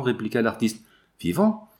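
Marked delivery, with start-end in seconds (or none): 1.2-1.7 sharply rising, high-pitched disbelief; astonished question. A narrator's reading. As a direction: shoot the pitch up in shock. Stunned echo of the news. (question stupéfaite, très montante)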